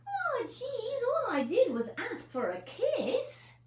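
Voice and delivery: aggrieved snake voice; snake's complaint. Acted. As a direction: huff it out, hurt and put upon. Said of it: "said the snake".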